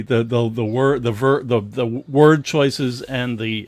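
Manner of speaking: ordinary talk, nothing marked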